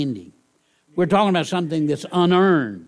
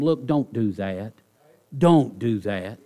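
gentle plea; 5 semitones lower than usual. Shown as a demonstration. Pastor showing how to plead with a friend, gently. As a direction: plead gently, don't yell. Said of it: "you're not yelling at them".

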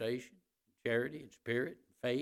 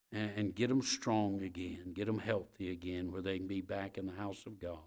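low-pitched, earnest congregational prayer; subdued pastor's prayer to God; closing prayer; 5 semitones lower than usual. Real, not acted. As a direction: pray aloud in a lowered, humble voice.

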